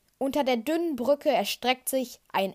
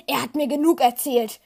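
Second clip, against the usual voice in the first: desperate; 3 semitones higher, 8 dB louder than usual. A narrator's reading. Luke shouts back at Vader in despair. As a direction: fling it back at him in despair. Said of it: "antwortet Luke verzweifelt".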